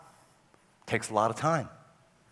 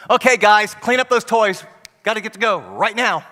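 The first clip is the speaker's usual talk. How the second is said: raised, higher call across the room; calling out to kids; 6 semitones higher, 8 dB louder than usual. Retold or imitated. Parent calling the kids to tidy up, raised call. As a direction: hurry the kids along, voice up.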